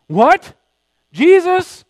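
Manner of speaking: shocked protest. Retold or imitated. Peter protesting to Jesus he'd never deny him, outraged. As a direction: yelp in outrage at the accusation.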